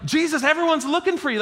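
high-pitched, quick, pestering plea; pressuring. Acted. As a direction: act out disciples pushing for attention. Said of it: "trying to push this pressure and expectation".

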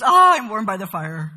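loud, self-satisfied exclamation of comfort; smug idol maker. Retold or imitated. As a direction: play the pleased idol maker warming his hands. Idol maker voiced, content.

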